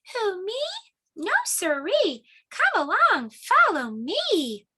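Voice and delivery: high, slow mouse voice; squeaky mouse. Acted. Mouse character.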